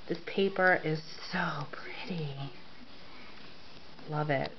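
soft, half-whispered gushing; hushed admiration. Real, not acted. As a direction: drop to a near whisper, adoringly. Hushed gushing over pretty paper.

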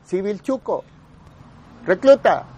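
high, loud jeering yell across the yard; retold yelling. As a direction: call him out like a recruit. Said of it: "me empiezan a gritar". (grito burlón desde el camión)

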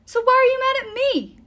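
loud, very high exasperated demand; quarrelling. A narrator's reading. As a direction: throw the question back in frustration. Quarrel between Will and Nico.

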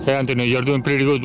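ordinary delivery

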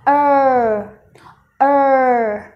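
"er" drawn out with the tongue curled back. Shown as a demonstration. Teacher showing learners the tongue curl, long R-coloured ER. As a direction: hold "er" with a strong American R colour. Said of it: "cong lưỡi vào nhá".

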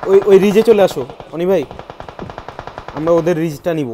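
loud, high calling out; rally the team, loud; excited shout. Real, not acted. Calling team to run to ridge.

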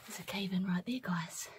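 hushed whisper in a tunnel; talking quietly. Real, not acted. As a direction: whisper so nothing caves in. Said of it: "I'm gonna talk quietly".

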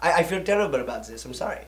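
put-on low, soft, hurried German-accented apology; deflated and small; sheepish apology. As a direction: shrink into a quiet, quick sorry. Pilot apologises after being rebuked.

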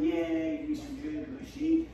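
high, sing-song dog-praise voice; squeaky praising a dog. Real, not acted.

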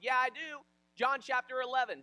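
loud, high, proud comeback; defiant retort. Retold or imitated. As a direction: fire back cockily. Acting the man's defensive retort.

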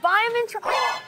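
high catchphrase. Shown as a demonstration. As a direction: sing it out like a show title. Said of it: "That should be a series".

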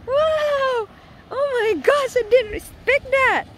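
high, loud delighted amazement; can't believe the catch; surprised. Real, not acted. Unexpected catch.

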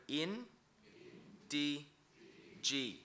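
"in, di, G" split into separate syllables with pauses. Shown as a demonstration. Teacher breaking down "in, di, G" for the class. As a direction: sound out "in, di, G" one syllable at a time. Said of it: "The next word".

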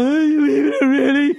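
hammed-up whiny wife voice; mock whiny wife. Husband mocking wife at the party, whiny and high. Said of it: "that's not how she sounds".

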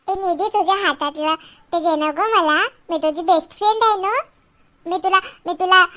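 ordinary talk

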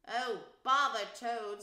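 loud, slow, exasperated outburst; savage. A narrator's reading. The rat loses patience with Toad, savage. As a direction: throw it out savagely. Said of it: "said the rat savagely".

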